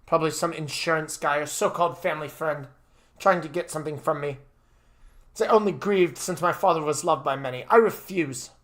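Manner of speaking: suspicious, then firmly refusing; distrustful young master. Acted. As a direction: argue back as a stubborn young master. Voices the refusing son.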